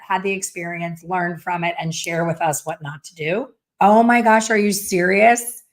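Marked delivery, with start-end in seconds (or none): 3.8-5.6 loud, astonished oh my gosh; real astonishment at a comment; surprised. Real, not acted. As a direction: blurt it out in disbelief. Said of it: "reacting to Risha's comment".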